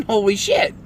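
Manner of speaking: normal talking voice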